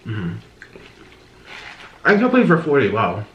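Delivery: normal talking voice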